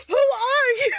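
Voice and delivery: high squeaky who are you, laughing at the end; bewildered and half laughing; incredulous. Retold or imitated. Reacting to creepy man.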